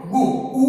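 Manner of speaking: "गु, उ" split to isolate the उ sound; vowel sound shown. Shown as a demonstration. Teacher isolating the one vowel sound in गुड, "गु, उ" broken apart. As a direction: say "गु", then the bare vowel "उ" on its own. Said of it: "यानी उ sound".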